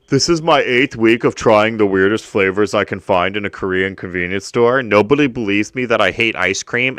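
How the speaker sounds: loud, sing-song mock of a vlogger kid. Mocking a kid's vlog intro, sneering loud mimicry. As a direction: sneer his intro like a smug YouTuber. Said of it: "this is this kid".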